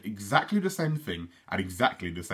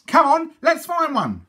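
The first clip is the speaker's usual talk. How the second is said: excited high twin voices; 7 dB louder than usual. Acted. Twins eager to find snails.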